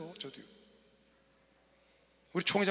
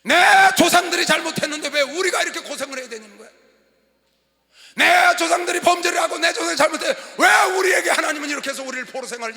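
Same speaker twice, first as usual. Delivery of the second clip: loud, raised voice of grievance; acted angry complaint; 8 semitones higher, 12 dB louder than usual. Exiles blaming ancestors for their suffering, acted angry complaint. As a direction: cry out in protest, imitating the aggrieved.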